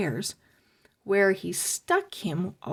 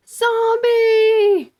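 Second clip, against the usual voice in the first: high, loud panicked shriek; screaming in terror; 14 semitones higher, 9 dB louder than usual. A narrator's reading. Webster seeing the zombie doll, shrieking high and loud. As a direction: scream the word in fright. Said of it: "Webster screamed".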